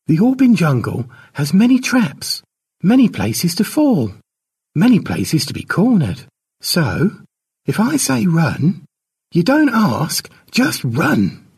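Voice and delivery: firm and grave, spell out each danger; serious warning. Acted. Elder kneels and warns her young charge before the jungle, serious and firm. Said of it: "looking serious".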